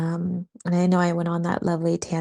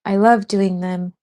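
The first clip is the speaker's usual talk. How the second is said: normal talking voice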